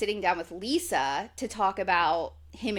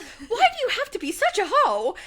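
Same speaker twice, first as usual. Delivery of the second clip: high, quick, half-laughing mock scold; mock angry sister; 11 semitones higher, 4 dB louder, faster than usual. Stassi scolding her sister, high mock anger. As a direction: impersonate a sister snapping in mock outrage. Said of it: "like, getting mad".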